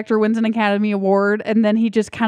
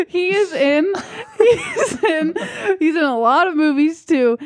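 laughing; 8 semitones higher than usual. Real, not acted. Laughing while naming roles.

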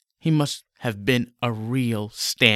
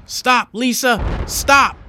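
higher, louder desperate cry; screaming; 13 semitones higher, 7 dB louder, slower than usual. Acted. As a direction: scream at her to stop. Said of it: "Roland screams".

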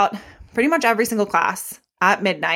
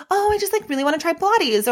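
mock client voice; 8 semitones higher, faster than usual. Imitating a client's surface reason.

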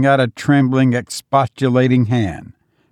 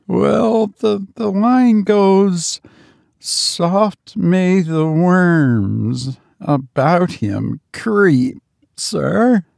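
frightened recitation; 7 semitones higher, much slower than usual. Acted. Frightened servant quotes grim poem.

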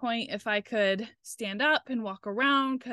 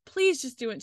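imitated pleading, pitched up; tearful begging; 4 semitones higher, 4 dB louder than usual. Patient begging for the epidural, acted tearful plea. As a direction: beg as if near tears. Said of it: "I'm, like, in tears".

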